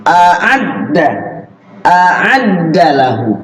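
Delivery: A'adda with a stretched throaty ain; slow, crisp A'adda lahu; vocal drill. Shown as a demonstration. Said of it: "Latihan vokal". (latihan vokal, huruf ain jelas)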